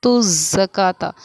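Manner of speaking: ज़काता drawn out slowly, each syllable clear; slow Quranic recitation. Shown as a demonstration. Teacher recites ज़काता slowly for students. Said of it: "इस तरह से हम इसको पढ़ेंगे".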